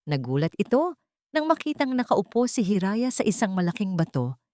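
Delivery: ordinary delivery, nothing marked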